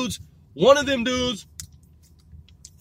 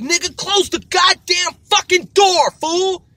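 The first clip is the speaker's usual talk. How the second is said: much higher, louder, slow "close the door"; higher, louder fed-up yell at the doorway; exasperated yell; 10 semitones higher, 9 dB louder, slower than usual. Retold or imitated. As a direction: yell at him to shut the door. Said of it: "And we was like".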